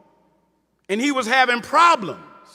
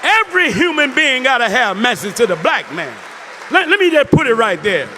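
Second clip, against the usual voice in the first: preaching at full force. Acted. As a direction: proclaim it to the whole hall. Preaching about the book.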